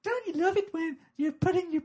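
very high, breathy-free falsetto pop star voice. Acted. Pop star doing a stand-up bit for the kids, very high. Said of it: "I'd like to see [name]".

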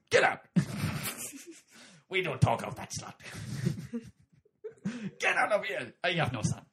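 loud, raging father's shout; angry God. Acted. God bellows at Jesus about his mother, loud and furious. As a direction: throw the son out in fury. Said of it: "And he goes".

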